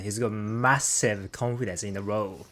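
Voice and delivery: ordinary talk, nothing marked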